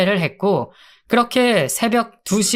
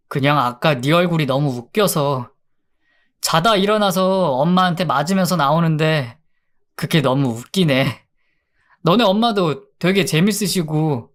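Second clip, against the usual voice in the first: usual speaking voice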